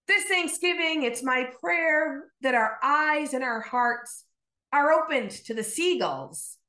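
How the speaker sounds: normal talking voice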